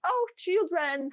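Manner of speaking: high, slow, sing-song hello; delighted greeting. Retold or imitated. Greeting kids on the road, a high slow delighted voice. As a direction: gush over the kids like a happy aunt. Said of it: "normally when I see kids".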